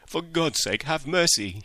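high, desperate cry for mercy; a frightened thief's high shriek; shrieked plea. A narrator's reading. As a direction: shriek it out, begging for his life. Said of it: "he shrieked".

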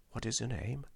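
very soft aside; hushed, secretive question; covert question. A narrator's reading. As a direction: slip it in under your breath. Interpreter slips in secret question.

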